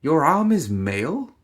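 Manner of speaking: voiced surprise, a touch incredulous; surprised. A narrator's reading. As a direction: caught off guard by her arm. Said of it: "The surprise crept into his voice".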